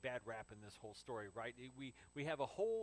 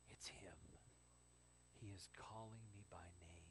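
very soft, low, near whisper; hushed realization; 8 semitones lower, 13 dB quieter than usual. Acted. Mary recognizing the voice of Jesus, hushed awe. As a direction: let it dawn in a hush.

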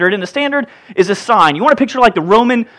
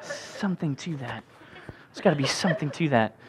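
muttered aside; 6 semitones lower, 17 dB quieter than usual. Real, not acted.

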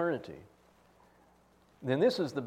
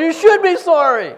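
much higher, much louder stern lecture; stern shout; 18 dB louder than usual. Retold or imitated. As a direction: drive the point home at full volume. Professor scolding loudly.

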